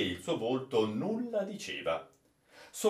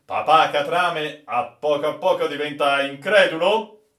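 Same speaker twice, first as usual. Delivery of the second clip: teasing captain; 5 semitones higher, 10 dB louder than usual. Acted. Captain teasing the old sailor.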